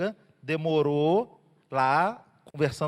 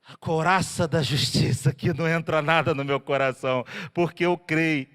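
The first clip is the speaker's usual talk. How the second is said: declaring faith; 3 semitones lower, 3 dB louder than usual. Shown as a demonstration. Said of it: "Você faz assim, ó, com o peito".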